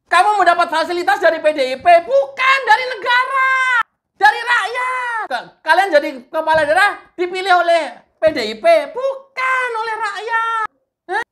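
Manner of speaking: high-pitched indignant tirade; angry rant. Real, not acted. Commentator challenging officials over who pays and elects them, angry rant. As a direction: scold them in a high, worked-up voice. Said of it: "jangan marah-marah".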